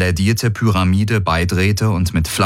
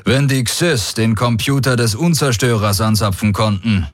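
higher-pitched, confident commander; self-assured warlord; 5 semitones higher than usual. Acted. Daraios answering the alien commander, self-assured and higher. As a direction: play the unruffled field commander.

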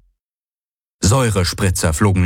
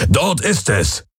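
higher, different voice, pointing; Hox calling out; 5 semitones higher, slower than usual. A narrator's reading. Hox points out the domed building, calling out. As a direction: announce the goal.

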